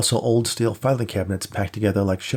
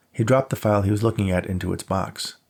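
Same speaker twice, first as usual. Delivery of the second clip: usual speaking voice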